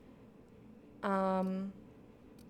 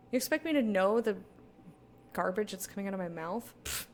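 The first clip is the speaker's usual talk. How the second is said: normal talking voice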